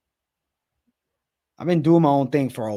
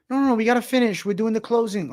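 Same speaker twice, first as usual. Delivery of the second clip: higher, mock-insistent boss voice; imitating the manager; 7 semitones higher, faster than usual. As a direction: play the manager insisting they stay, higher. Manager's order retold.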